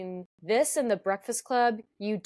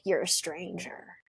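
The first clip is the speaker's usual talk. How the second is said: slow, softer mock-snide quote; snide imitation; 3 semitones lower, 5 dB quieter, slower than usual. Quoting the kid rebuffing a store clerk, mock-snide. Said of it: "he snidely is like".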